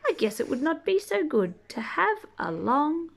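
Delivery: gentle storybook read-aloud; reading to children. Acted. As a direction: read it like a bedtime picture book. Reading picture book aloud.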